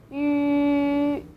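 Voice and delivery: "Yu" said as yi with rounded lips. Shown as a demonstration. Teacher modelling the vowel for learners, twice. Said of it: "then you might get the sound".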